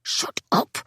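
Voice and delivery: sharp unvoiced hiss of "shut up". A narrator's reading. Tess hisses at the narrator behind the door, whispered. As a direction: hiss it at her to be silent. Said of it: "she hissed".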